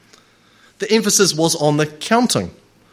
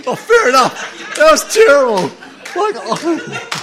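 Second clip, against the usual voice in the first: laughing; 10 semitones higher than usual. Real, not acted. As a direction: laugh at your own bad accent. Laughing at own bad accent.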